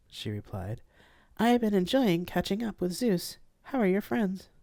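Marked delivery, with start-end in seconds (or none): 1.4-4.5 higher polite AI voice; gentle formal ship AI. Acted. AI ship Nematona speaking.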